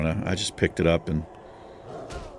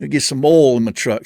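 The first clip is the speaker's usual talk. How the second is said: Southern husband; 6 semitones higher, 7 dB louder than usual. Retold or imitated. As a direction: play the husband as a loud Southern truck guy. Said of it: "not to talk so Southern".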